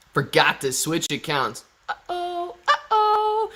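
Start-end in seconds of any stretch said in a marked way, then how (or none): 1.9-3.5 squeaky, much higher uh-oh; sing-song cartoon uh-oh at a goof; playful high uh-oh after a slip; mock uh-oh. Switched accounts by mistake.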